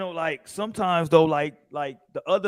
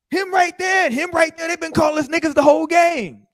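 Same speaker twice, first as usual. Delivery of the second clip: higher, louder heated teammate yelling; 10 semitones higher, 4 dB louder than usual. Retold or imitated. Teammate yelling about the other team's insults. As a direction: shout it out like a furious teammate. Upset teammate pointing out culprits.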